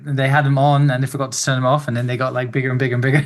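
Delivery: ordinary talk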